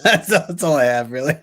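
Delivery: giggling through the words; breaking up laughing. Real, not acted. Laughing at the pose.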